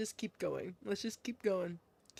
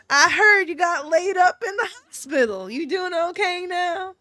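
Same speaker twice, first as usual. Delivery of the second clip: hearty folksy woman; 4 semitones higher, 7 dB louder than usual. Acted. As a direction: greet an old acquaintance with big folksy surprise. Voices Missus Kyozuka and her greeter.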